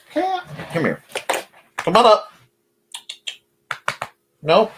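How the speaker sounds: high sing-song calling the cat; pet talk. Real, not acted. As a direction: coax the cat up in a sweet high voice.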